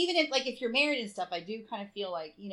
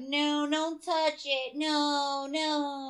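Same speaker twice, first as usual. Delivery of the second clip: slow, drawn-out pretend refusal; mock refusal; 4 semitones higher, much slower than usual. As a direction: play a prissy no, stretching it. Mock refusal of partner's touch.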